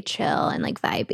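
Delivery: low creaky, unbothered voice; vocal fry. Shown as a demonstration. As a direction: drop into a lazy vocal fry. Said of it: "you have to just".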